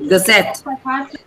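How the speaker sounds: "gazette" with a short ga and strong ZET; last syllable stressed. Shown as a demonstration. Teacher correcting a student, "gazette" stressed on ZET. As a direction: shrink the first vowel, land on ZET in "gazette". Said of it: "this syllable should be stressed".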